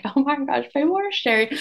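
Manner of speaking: retold excited squeal. Mom on maternity leave sees her reel shared, excited. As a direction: replay the thrill of being shared. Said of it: "And I was like".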